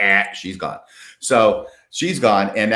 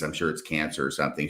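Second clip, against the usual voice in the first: normal talking voice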